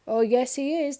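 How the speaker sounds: loud, rising insistence; shouting back. A narrator's reading. The crowd shouting back at Miss Trouble, loud and insistent. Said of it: "they all shouted".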